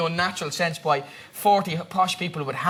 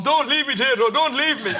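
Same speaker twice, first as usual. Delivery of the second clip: higher-pitched comic pleading; abandoned rider; 5 semitones higher, 3 dB louder than usual. Acted. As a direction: call out pleadingly after a runaway horse.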